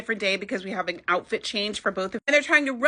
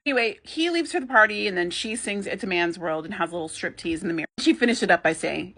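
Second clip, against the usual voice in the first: ordinary talk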